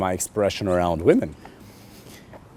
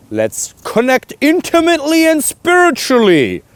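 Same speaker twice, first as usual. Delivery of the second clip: loud, high, drawn-out declaration; exuberant proclamation; 6 dB louder than usual. Acted. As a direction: proclaim it grandly, stretching every word. Hammed-up example of inviting a date.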